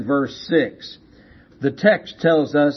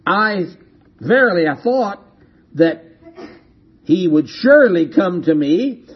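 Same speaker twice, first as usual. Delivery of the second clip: louder, indignant King James tirade; rising outrage, louder, mock pompous; 6 semitones higher, 4 dB louder than usual. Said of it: "in his furiousness".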